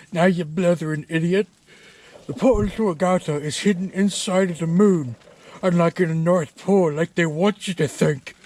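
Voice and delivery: ranting Scottish brogue; Scottish accent. Acted. A mad Scotsman insults the homeowner, in a Scottish accent. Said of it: "doing that Scottish accent".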